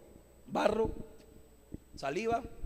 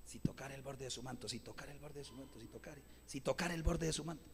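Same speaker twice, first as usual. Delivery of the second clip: much softer, hushed repeated murmur; woman's quiet murmur; 6 semitones lower, 15 dB quieter than usual. Acted. Woman quietly hoping to touch Jesus's cloak, softly murmuring. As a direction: murmur it to yourself, barely heard. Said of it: "una mujer que no gritó, solo decía".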